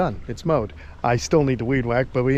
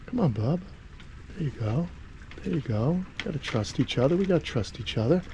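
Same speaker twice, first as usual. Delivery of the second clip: much softer, gentle coaxing; calm him down, quietly; soothing murmur; 8 dB quieter than usual. Real, not acted. Keeper quietly coaxing his nervous monitor to trust him.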